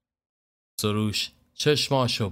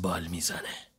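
much softer and lower, like a bird gliding at ease; gentle soft flutter; 5 semitones lower, 9 dB quieter than usual. A narrator's reading. Khosrow telling his man how a free bird flaps, softly. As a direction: let the words float down quietly.

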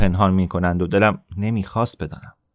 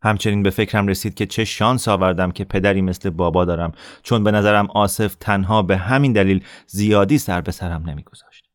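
usual speaking voice

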